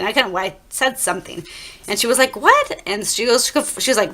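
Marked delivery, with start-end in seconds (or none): 2.4-2.8 very high, loud shouted what; mom calling back. Retold or imitated. Mom shouting back up from the street, very high. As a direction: mom yelling back from afar.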